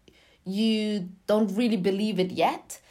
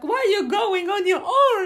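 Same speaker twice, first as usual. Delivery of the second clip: high, loud mock alarm; alarmed family protest; 13 semitones higher, 7 dB louder than usual. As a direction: imitate fretting relatives, pitched way up. Retelling worried family's protest.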